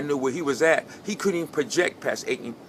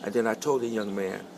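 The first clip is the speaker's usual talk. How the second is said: ordinary talk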